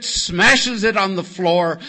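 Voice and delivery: ordinary delivery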